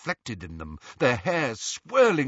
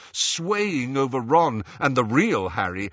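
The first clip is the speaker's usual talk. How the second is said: ordinary delivery, nothing marked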